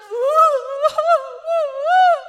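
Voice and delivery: high, drawn-out hammed-up wail; loud mock weeping. Preacher shows church how she weeps, loud mock wailing. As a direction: bawl theatrically at full volume. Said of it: "I am weeping loud".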